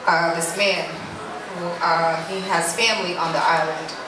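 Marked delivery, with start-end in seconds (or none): none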